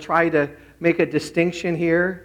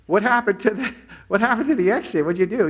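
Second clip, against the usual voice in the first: higher, mock-bewildered questioning; confused; 6 semitones higher than usual. Confused man asks the nurse about the exit, bewildered. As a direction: act out a lost man's puzzled questions. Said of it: "The nurse sees him confused".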